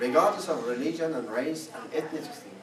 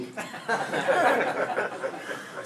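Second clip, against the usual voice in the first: laugh; 7 semitones higher than usual. Real, not acted.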